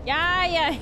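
mock North Dakota accent. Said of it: "It's just North Dakota".

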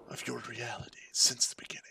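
hushed, eerie whisper; spooky whisper. Pretend. Gamer intoning a spooky line, ominous whisper.

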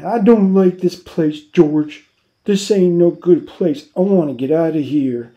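loud, high, distressed outburst; crying out. A narrator's reading. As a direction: burst out loud and upset. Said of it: "Lenny cried out suddenly".

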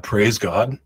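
usual speaking voice

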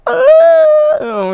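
very high, loud fake sob; mock wail. Winner overcome on stage, fake wailing. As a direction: let out a hammed-up crying wail. Fake crying during acceptance speech.

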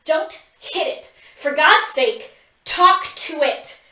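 exasperated command; order. A narrator's reading. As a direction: bark orders at both. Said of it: "Pip orders".

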